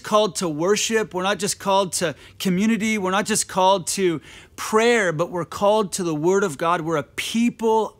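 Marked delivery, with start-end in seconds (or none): none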